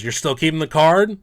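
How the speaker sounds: mock the choice with disbelief; incredulous roommate. Roommate ribbing him on his way out, incredulous. Roommate teasing about the card.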